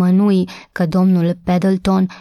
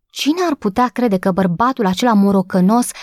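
usual speaking voice

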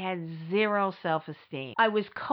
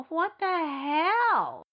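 exasperated disbelief; 6 semitones higher, much slower than usual. Real, not acted. Outraged retelling.

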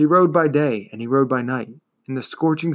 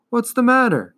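higher-pitched, mildly urgent question; concerned questioning; 10 semitones higher, 3 dB louder than usual. A narrator's reading. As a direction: ask with a touch of alarm. Atreyu questions his sluggish horse.

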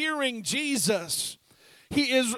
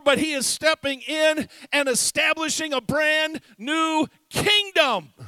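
louder, driving preacher's climax; fiery preaching; 3 semitones higher, 5 dB louder than usual. Acted. Preacher urging the congregation, loud and fervent. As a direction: build to a preaching peak.

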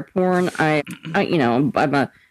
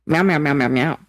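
low, playful meowing; lightly mewed cat sounds; cat meows; 5 semitones lower than usual. Pretend. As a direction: meow like a pet cat. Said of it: "I unironically meow".

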